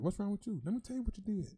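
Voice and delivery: high, pushy mock challenge; demanding to know what a friend did, high and mock-indignant; mock confrontation. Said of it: "and then".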